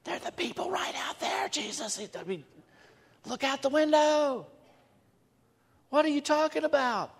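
mock baffled crowd. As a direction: play the crowd as baffled that he asks. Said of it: "And they'll go like".

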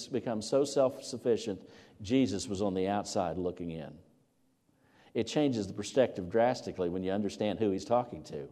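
ordinary delivery

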